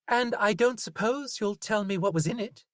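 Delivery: Zoe, haggling with the butcher; Zoe's voice. A narrator's reading. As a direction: brush it off as Zoe. Zoe teases Linus.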